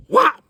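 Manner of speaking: a sharp, very loud high whop; cartoon thud of a hammer hitting a head; hammer smack sound. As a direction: slap out the impact of the hammer. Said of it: "that hammer goes".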